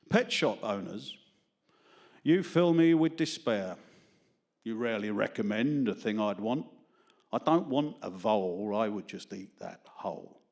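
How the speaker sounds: loud, measured poetry declamation; mock-grand verse reading with pauses; comic poem recital. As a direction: declaim it line by line to the room.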